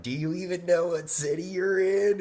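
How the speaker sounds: high, loud, scornful mimicry of the rude receptionist; mock her snippy disbelief, up high; imitating a rude phone clerk, loud and high; rude sneer. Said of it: "the lady that answered was rude".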